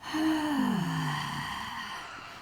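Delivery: long, soft audible sigh out; big sigh. Shown as a demonstration. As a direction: let a big breath go in a slow sigh. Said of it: "take a big sigh".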